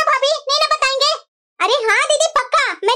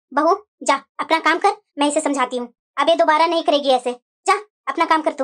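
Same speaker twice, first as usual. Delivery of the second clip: stern mother-in-law; 11 semitones lower than usual. Acted. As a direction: send the bahu off firmly as the elder. Mother-in-law figure held many lines.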